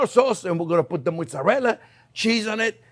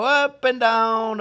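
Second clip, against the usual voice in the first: slow demo; 4 semitones higher, 3 dB louder, much slower than usual. Shown as a demonstration. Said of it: "See, look, look".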